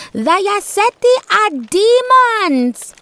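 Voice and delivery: high, loud accusing shout; woman shouting. A narrator's reading. Villager woman accuses the Yaseti across the circle, shouting. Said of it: "one woman shouted from across the circle".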